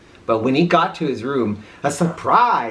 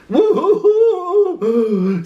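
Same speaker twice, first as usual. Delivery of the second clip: high, slow hammed-up wail; boo-hoo like a cartoon crybaby; mock sobbing; 4 dB louder, much slower than usual. A narrator's reading. Frightened dogs sobbing, high and hammed-up. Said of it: "sobbed the dogs".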